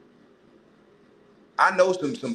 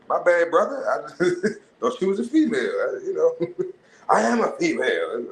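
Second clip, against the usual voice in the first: flustered apology; 5 semitones higher than usual. Retold or imitated.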